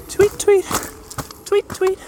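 very high chirpy tweets; cartoon bird voice. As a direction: squeak the tweets like a tiny bird. Imitating bird, higher.

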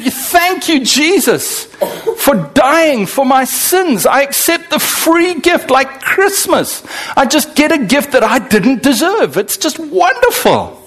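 high, elated new believer; joyful gratitude. Acted. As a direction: gush with thanks, drawing out key words. Acting out a grateful new convert.

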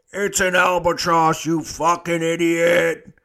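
loud scolding bark; angry boss. Retold or imitated. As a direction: chew him out slowly.

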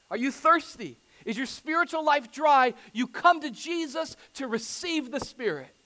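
loud, driving sermon climax; preaching at full force. Real, not acted. Pastor urging the congregation, preaching at full force.

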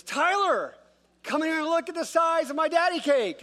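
high, loud imitated kid's holler; calling out. Little sister calls brother to see her pancake, calling out. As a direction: holler boastfully across the house.

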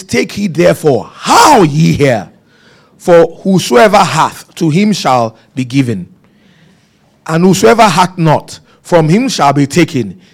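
slow, emphatic King James reading; solemn Bible reading; scripture reading. Acted. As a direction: read the verse with weight.

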